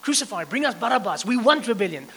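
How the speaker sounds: loud jeering cry of the mob; a mob shouting for blood, loud and raw; retold crowd shout. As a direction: shout it like the crowd calling for Barabbas. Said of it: "All those guys who just shouted".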